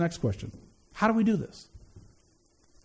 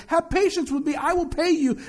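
higher-pitched servant's plea; pleading; 8 semitones higher than usual. Acted. Debtor servant begs fellow servant for patience, pleading. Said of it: "fell down and pleaded with them".

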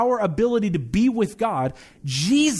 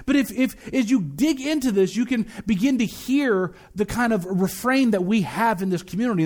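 ordinary talk, nothing marked

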